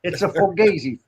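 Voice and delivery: New York mobster patter; New York wise guy. Acted. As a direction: sound like a New York made man. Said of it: "New York voice".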